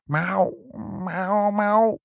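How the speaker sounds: high, drawn-out cat calls; cats meowing. A narrator's reading. Two cats run into the room, high meowing. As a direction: miaow like a calling cat. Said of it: "riepen Rozenkrans en Guildenstern".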